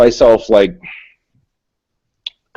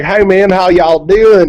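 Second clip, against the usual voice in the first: slow mock southern greeting, higher; lazy y'all drawl, imitating locals; 6 semitones higher, slower than usual. Locals greeting a New Englander, slow mock southern drawl. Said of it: "people out here are always like".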